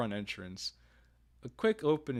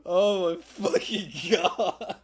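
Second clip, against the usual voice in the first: real laughter, slow and louder; laughing; 6 semitones higher, 6 dB louder, much slower than usual. Real, not acted. Gamer cracking up at own joke, laughing hard. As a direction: stretch it out loud through helpless laughing.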